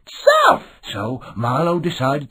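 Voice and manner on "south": excited shout. Acted. Answer to the question.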